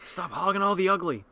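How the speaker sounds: hammed-up teasing line; mock cartoon dad. Teasing his friend, mock cartoon insult.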